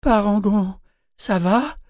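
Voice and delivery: high, worried call; anxious cry. A narrator's reading. Ambre checking on the ship as it lifts, anxious cry. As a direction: call out in alarm to check on him. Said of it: "cria-t-elle angoissée".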